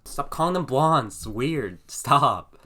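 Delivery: hammed-up exasperated yell; exasperated protest. Acted exasperated protest at teasing.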